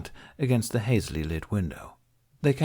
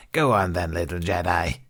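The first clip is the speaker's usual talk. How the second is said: taunting. A narrator's reading. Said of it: "Gardula taunted".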